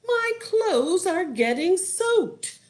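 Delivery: high, stretched-out complaint; singsong protesting wife; wizard's wife voice. A narrator's reading. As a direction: complain sweetly in a high, slow voice. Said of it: "said the wizard's wife".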